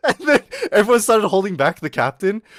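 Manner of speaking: laughing. Real, not acted. Storyteller cracking up at his classmate's insult, laughing. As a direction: keep narrating as the laugh takes over.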